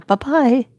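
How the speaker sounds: high, dismissive sing-song; sing-song bye-bye. Retold or imitated. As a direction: wave him off with a lilting high goodbye. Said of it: "I did, straight up".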